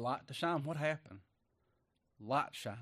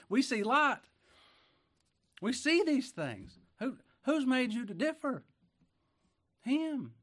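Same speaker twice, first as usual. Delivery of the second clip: much higher, louder preaching cry; preaching peak; 11 semitones higher, 5 dB louder, slower than usual. Acted. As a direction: lift the church with it. Preaching peak, high and loud.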